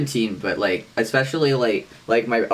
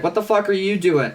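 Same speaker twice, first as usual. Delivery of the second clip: annoyed call; 8 semitones higher than usual. Pretend. Acted roommate exchange.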